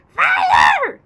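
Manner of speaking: put-on high, loud shrieking old crone; shout. A narrator's reading. Grandma with burning stomach shrieks for help, high and loud. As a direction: scream for help like an old hag. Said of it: "the old woman yelled".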